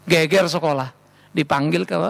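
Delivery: ordinary talk, nothing marked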